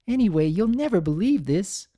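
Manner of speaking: high, bubbly gossiping mare; giggly, gleeful young pony; Ember's chatter. Acted. Ember gossiping to the statue, high and giddy.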